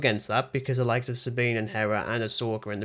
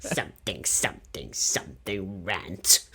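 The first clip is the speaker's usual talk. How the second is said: low, slow Sith lord voice, whispered middle; imitating a sinister emperor, laughing; Emperor Palpatine; 5 semitones lower, 4 dB quieter, slower than usual. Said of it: "sound a bit like Emperor Palpatine".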